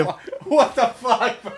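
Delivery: laughing in disbelief; cracking up mid-question. Real, not acted. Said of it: "I almost fell out of the chair".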